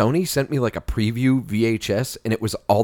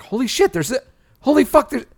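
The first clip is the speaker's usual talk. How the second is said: imitating amazement; 12 semitones higher than usual. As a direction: gasp out the surprise at each famous face. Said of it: "you're like".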